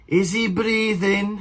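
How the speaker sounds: operator question. Retold or imitated. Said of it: "I was like".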